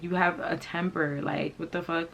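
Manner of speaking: normal talking voice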